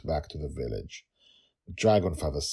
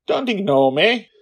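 high, very loud demand; girl yelling; 12 dB louder than usual. Acted. Girl demands the goblin monster listen, loud yell. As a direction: shout it like a stubborn child. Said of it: "Dragon Lil yelled again".

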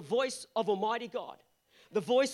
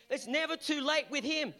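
usual speaking voice

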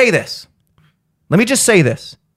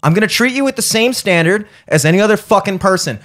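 ordinary delivery

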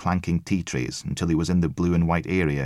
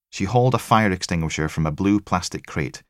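plain reading voice

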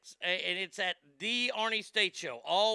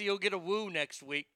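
ordinary talk, nothing marked

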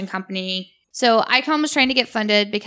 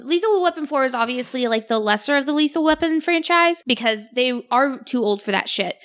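normal talking voice

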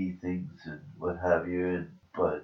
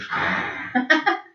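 high burst of laughter; 8 dB louder than usual. Real, not acted. Laughing at her own slip.

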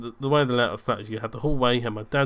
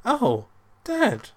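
much higher, louder startled outburst; mock panic; 11 semitones higher, 5 dB louder, slower than usual. Son finding his dad in the hallway, acted panic. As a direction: act out being caught out, voice shooting up. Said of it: "in a bit of a, a panic".

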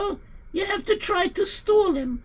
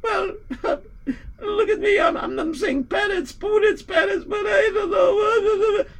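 crying. Acted. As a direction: sob out the words, breaking down. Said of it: "don't cry".

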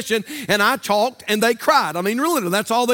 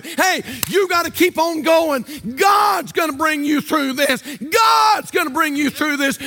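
higher-pitched, fervent preaching; 4 semitones higher than usual. Retold or imitated. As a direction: preach encouragement with rising conviction.

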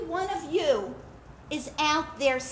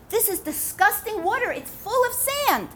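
raised, disgusted complaint; disgusted landowner; 8 semitones higher, 5 dB louder than usual. Acted. Landowner tasting sandy tea, disgusted and raised. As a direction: spit out the words in disgust.

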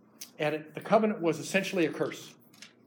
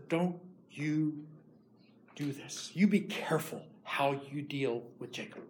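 stern quiet warning; 2 semitones lower, 7 dB quieter than usual. Acted. The Lord warning Laban in a dream, hushed and stern. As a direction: warn him softly but with menace.

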